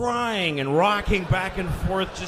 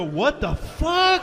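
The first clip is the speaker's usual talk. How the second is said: stunned drivers; 6 semitones higher, 4 dB louder, slower than usual. Retold or imitated. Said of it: "people in their cars go".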